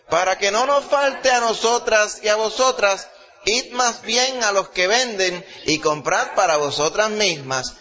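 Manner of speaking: lightly voiced wise virgins turning them away; wise virgins answering. A narrator's reading. Wise virgins refusing the foolish ones, lightly voiced and firm. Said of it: "respondieron diciendo".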